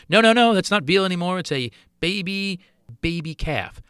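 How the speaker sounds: loud, high mock objection; mock horrified correction. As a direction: jump in loudly, like an outraged correction. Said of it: "and somebody goes".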